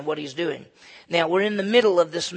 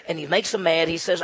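ordinary delivery

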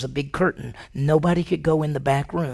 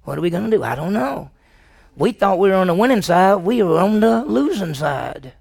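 raised voice building to loud dismay; lost, dismayed disciple; 4 semitones higher, 5 dB louder than usual. Acted. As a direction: play a follower at a total loss. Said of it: "You'd say".

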